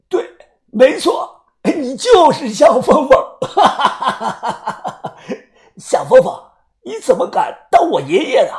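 high, laughing, manic; gleeful madman. Acted. Madman gloating over his captive, high and laughing. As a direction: crow with mad glee.

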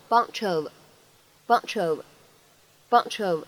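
"bunch of" blended as "bunchuv"; slow linked "bunch of"; linked reading. Shown as a demonstration. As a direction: slide the ch straight into "of". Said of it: "我们呢可以读成bunch of".

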